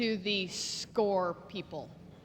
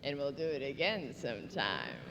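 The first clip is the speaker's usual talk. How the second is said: lower, softer suave leading-man voice; a lightly done smooth Jack Nicholson; mock-suave low come-on; 9 semitones lower, 9 dB quieter than usual. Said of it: "And he goes".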